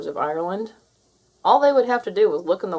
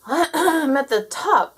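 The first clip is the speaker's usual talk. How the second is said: slow, higher, out of breath from the climb; puffing; 7 semitones higher, 6 dB louder, much slower than usual. A narrator's reading. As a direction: gasp it out after the long climb. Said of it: "puffed Jake".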